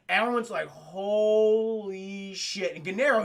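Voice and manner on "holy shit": very slow, drawn-out amazement; awestruck imitation. Acting out everyone seeing the T-Rex, awestruck. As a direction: stretch each syllable in stunned awe. Retold awe at T-Rex reveal.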